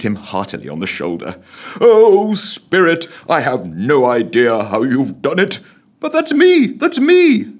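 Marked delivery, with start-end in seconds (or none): 1.8-7.5 high, loud joyful cry; excited. A narrator's reading. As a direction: burst with delight. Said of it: "He pointed excitedly".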